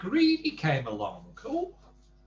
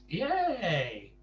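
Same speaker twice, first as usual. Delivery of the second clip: ordinary delivery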